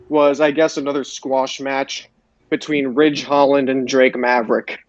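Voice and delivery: ordinary talk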